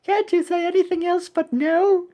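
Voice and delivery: high-pitched cartoon complainer; exasperated high character voice. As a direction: complain in a squeaky little voice. Voices asker to conch.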